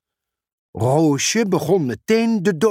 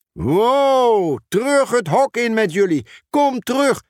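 raised, high calling voice; 10 semitones higher than usual. Acted. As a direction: shout after the runaway sheep. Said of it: "riep hij uit".

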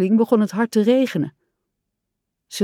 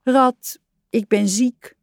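mildly plaintive, pitch lifted; sad; 5 semitones higher, slower than usual. A narrator's reading. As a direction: announce it with a touch of misery. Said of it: "zat Kikker er treurig bij".

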